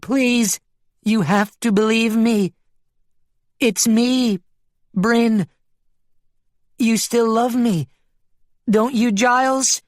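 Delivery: high-pitched, imploring sheep; desperate pleading. A narrator's reading. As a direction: beg a lost lover to believe you. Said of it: "the Bryn sheep pleaded".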